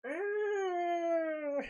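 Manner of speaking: very high screeching monster roar; Godzilla roar. Retold or imitated. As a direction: try your Godzilla cry. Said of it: "me trying to do".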